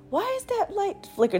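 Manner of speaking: put-on high, hushed little-kid question; curious kid wondering. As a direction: ask it like a wide-eyed seven-year-old. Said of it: "and I'm just".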